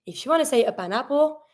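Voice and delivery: normal talking voice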